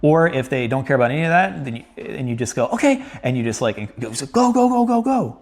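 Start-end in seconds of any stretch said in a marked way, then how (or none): 2.7-3.0 high, bright release call; excited release cue. Retold or imitated. 4.1-5.4 rising high go go go; excited cheering. Retold or imitated. Cheering the dog to run.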